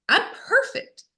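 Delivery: high mocking sing-song; high, sarcastic little voice. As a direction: parody your own smugness. Mocks her old self-image.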